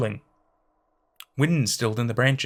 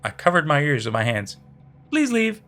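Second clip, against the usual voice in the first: high, looping cheery recording; glitching voicemail; 8 semitones higher than usual. Acted. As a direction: repeat like a skipping recording.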